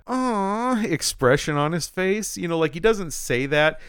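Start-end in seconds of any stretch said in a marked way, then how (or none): 0.1-0.8 put-on high whiny childish aw; a pouting man-child's whine; sulky aw. Dad pouting at his wife's request, whiny aw. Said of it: "he gets this kinda".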